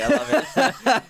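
high, slow laughing I love it. Real, not acted. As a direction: squeal it through laughter. Laughing about patron.

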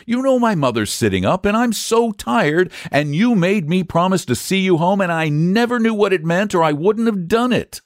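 put-on high, tearful-sounding little boy; acted piteous boy, pitched well up; piteous whine. A narrator's reading. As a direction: plead wearily like a child kept up too late. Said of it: "cried the boy piteously".

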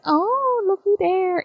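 high-pitched playful "oh, look" voice; hammed-up sightseer's delight; mock gawking. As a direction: coo it in high, exaggerated wonder. Acting out gawking on the floor.

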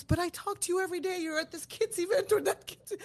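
a laughing best friend objects; laughing protest. Retold or imitated. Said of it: "she goes".

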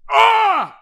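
giraffe scream. Retold or imitated. Cohost imitating a giraffe's scream, loud and high. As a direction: screech like a giraffe. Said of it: "já viu o grito da girafa?".

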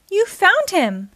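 high, slow, delighted exclamation; excited discovery. A narrator's reading. Pablo sees the bone's owner found, excited exclamation. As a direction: draw it out with excitement.

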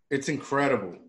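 ordinary talk, nothing marked

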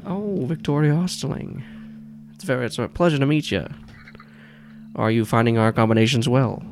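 side-mouth New York talk round a cigar; Gordon Pym. Acted. Older stoic boss greets a visitor, slight New York accent. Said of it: "he has, like, this slight New York accent".

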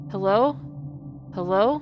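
calling out. Acted. Mark calling down the staircase into the dark, loud. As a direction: call it down the stairs, rising.

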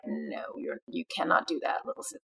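low, hushed big-brother refusal; hint of a stern big brother, voice dropped; imitating protective big brother. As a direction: lightly imitate a brother quietly laying down the law. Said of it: "big brother, little sister relationship".

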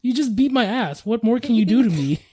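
high, loud mock outrage; indignant protest. Child talking back to dad after a beating, indignant protest.

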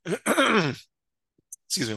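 hoarse, strained voice; throat catching mid-sentence; voice giving out. Real, not acted. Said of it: "I'm losing my voice".

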